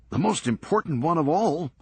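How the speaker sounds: teasing Serge. Acted. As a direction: needle Lenny about his frozen-in-time room. Serge about his tape collection.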